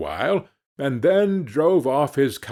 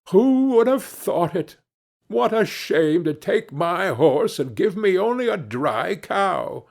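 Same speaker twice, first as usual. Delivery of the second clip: high-pitched, indignant lament; dismayed complaint; 6 semitones higher than usual. A narrator's reading. Hans complains to the butcher about the swindle, dismayed. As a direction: bemoan a bad bargain in a raised voice.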